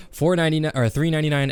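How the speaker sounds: ordinary talk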